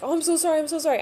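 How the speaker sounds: high, sweet, fussing apology; apologetic nurse. Retold or imitated. Nurse apologizes to the crying patient, high and sweet. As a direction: imitate the nurse fretting over her. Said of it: "and she was like".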